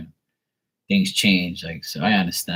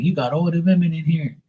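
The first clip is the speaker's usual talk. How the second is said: higher-pitched mocking complaint; retold accusation, pitched up; mock hater; 8 semitones higher than usual. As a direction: imitate a jealous hater's whine, lightly. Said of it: "they'd be hating on me".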